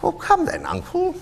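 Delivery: high, coaxing protest; cheerful nephew. Acted. Nephew coaxes his grumpy uncle, cheerful. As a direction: coax lightly in a high voice.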